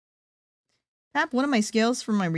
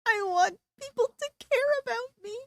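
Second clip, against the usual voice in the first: whiny voice; 5 dB quieter than usual. Acted. Whiny high voice.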